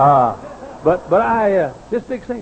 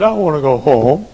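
insisting; 4 semitones higher, 10 dB louder than usual. Retold or imitated. Dying old man insisting.

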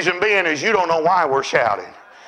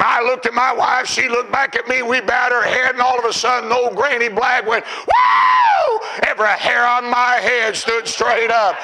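raised, high, shouting preacher cadence; preaching at full force; 8 semitones higher than usual. Acted. Preacher stirring the congregation about worship, full force.

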